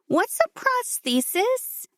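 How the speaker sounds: put-on high, slow child's question; wide-eyed kid asking innocently; curious little girl. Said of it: "Morgan asked".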